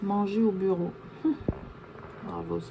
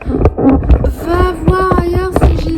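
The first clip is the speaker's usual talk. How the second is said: high, loud, slow drawn-out shout; sing-song it loud to shoo him off; playful yell; 10 semitones higher, 14 dB louder, much slower than usual. Real, not acted. Mother shooing Diego away from filming, loud sing-song yell.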